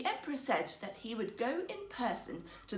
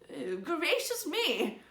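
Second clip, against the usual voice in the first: slow, higher, dismayed gasp of words; aghast old man; 6 semitones higher, much slower than usual. Acted. Old minister seeing empty looms, slow and aghast. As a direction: let the horror dawn slowly. Old man's shocked thought.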